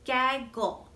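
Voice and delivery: "gaggle" in two clear beats, gag and gle; syllable by syllable. Shown as a demonstration. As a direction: separate "gaggle" so both syllables can be counted. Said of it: "That's right, two syllables".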